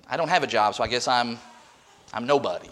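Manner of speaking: low, soft, halting admission; acting out a deflated, hesitant reply; dejected. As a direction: trail down as if ashamed to answer. Voicing a jobless person's ashamed answer.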